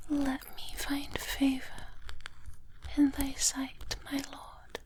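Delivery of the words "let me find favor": usual speaking voice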